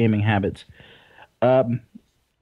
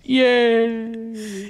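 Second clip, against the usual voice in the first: mock cheer; 10 semitones higher than usual. Copying the other's faint distant cheer.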